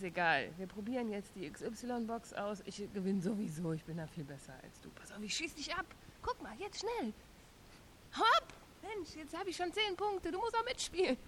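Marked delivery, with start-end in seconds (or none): 4.9-11.2 high, shrieking gamer excitement; excited. Acted. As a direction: yell at the game, all fired up. Child gaming in a frenzy.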